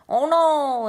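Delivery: hammed-up cry, high and loud; mock wail. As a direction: overplay the shocked groom's dismay. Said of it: "he's all".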